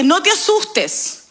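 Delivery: drawn out and pitched high; God's comforting command, slowed down; slow solemn reassurance. Acted. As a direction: lean into each word like a promise.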